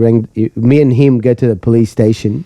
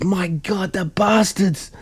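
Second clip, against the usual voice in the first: higher-pitched, hushed indignation; mock outrage; 6 semitones higher, 8 dB quieter, much slower than usual. Boss reacts to the invented thieves, appalled.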